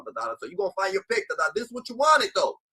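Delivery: ordinary delivery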